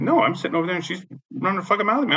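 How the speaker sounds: fast, high-pitched complaint; retold indignant protest. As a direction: replay protesting to the cops in a rush. Retelling his protest to police.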